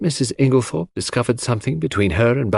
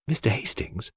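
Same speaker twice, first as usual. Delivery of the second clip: softer, confiding call of his name; dropped voice; 8 dB quieter than usual. A narrator's reading. Cynthia quietly draws Hastings aside, dropped voice. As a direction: drop your voice so Mary can't hear. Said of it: "dropping her voice".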